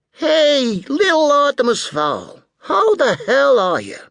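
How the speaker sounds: loud-mouthed, high-pitched greeting; brash American businessman. A narrator's reading. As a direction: hail him showily in a high voice.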